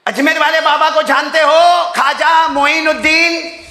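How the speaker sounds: rally the audience; lively preacher's call. Acted. Preacher's joking rise.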